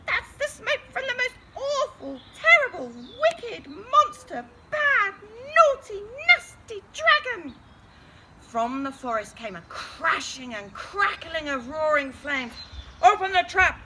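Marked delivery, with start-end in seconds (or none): 0.1-7.6 high, loud wizard voice piling up the words; a fussy old wizard raising the alarm. Acted. The wizard warns everyone about the awful dragon, high and loud. 13.0-13.8 loud, commanding shout; shouting an order. A narrator's reading. The knight orders the trap opened, loud shout. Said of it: "shouted Sir No Bonk".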